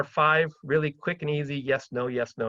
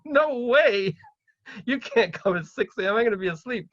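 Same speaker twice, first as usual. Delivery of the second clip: indignant refusal; 8 semitones higher than usual. Acted. Seller refusing a six AM visit, mock indignant. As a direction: balk at the absurd hour.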